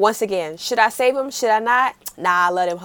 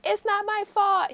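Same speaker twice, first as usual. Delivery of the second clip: high, slow protest; hurt son; 10 semitones higher, slower than usual. Retold or imitated. Retelling the drama, son protesting to his mother, mildly hurt. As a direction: protest like a wounded kid. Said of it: "And he's like".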